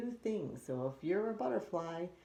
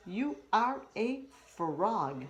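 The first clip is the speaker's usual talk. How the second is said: slow emphasis; 5 semitones higher, 4 dB louder, much slower than usual. Acted. Dad stressing the point.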